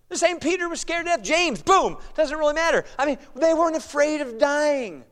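high, forceful preaching; fired-up preaching. Real, not acted. Preacher on the apostles' fearlessness in Acts, fired-up preaching. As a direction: proclaim it boldly, pitch lifted.

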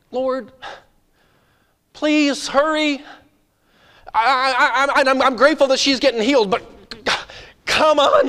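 high, loud, desperate pleading; frantic Jairus. Acted. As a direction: beg the Lord to hurry, stammering with urgency. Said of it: "put yourself back in Jairus' shoes".

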